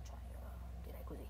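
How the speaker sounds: whispered side comment; a quick whispered correction; whispered aside. Real, not acted.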